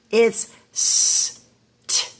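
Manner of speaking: st breathed with no voice, as one blend; sounding out st. Shown as a demonstration. Teacher showing the first sound st to her class. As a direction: hiss st as a single whispered blend. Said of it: "there's no letter that makes the sound st".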